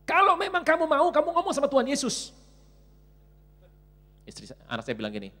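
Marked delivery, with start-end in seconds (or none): 0.0-2.3 loud, high exasperated outburst; angry wife. Acted. Wife snapping at begging child, loud angry yell. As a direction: blow up at the nagging child. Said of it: "Marah istri saya".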